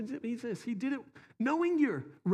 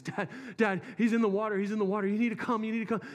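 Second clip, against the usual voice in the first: urgent retold plea; 5 semitones higher, faster than usual. Son calling dad to the boy in the water, retold urgent plea. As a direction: repeat each cry for help urgently, lightly acted.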